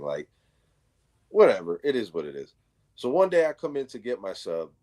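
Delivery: usual speaking voice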